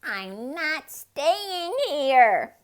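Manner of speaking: slow, loud, high wail. Acted. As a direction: wail it out in tears. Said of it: "wailed the duck".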